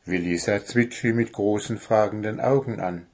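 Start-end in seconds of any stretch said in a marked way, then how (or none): none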